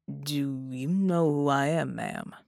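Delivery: slow, deliberate male officer voice; cautious officer. A narrator's reading. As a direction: ask carefully, drawn out.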